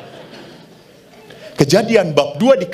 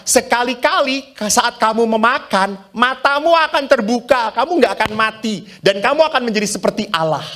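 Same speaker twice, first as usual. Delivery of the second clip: higher, quicker tempting voice; lightly voiced sly tempter; the evil one; 9 semitones higher than usual. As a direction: coax them like the serpent. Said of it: "Si jahat berkata".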